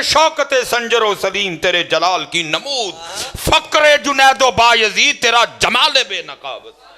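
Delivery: slow, high-pitched declamation of a couplet; verse recitation. Acted.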